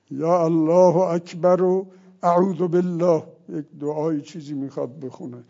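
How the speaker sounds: ordinary talk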